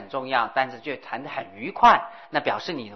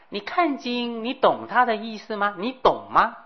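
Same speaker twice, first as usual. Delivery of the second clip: put-on teasing old hag; 7 semitones higher than usual. Old hag questions Naropa.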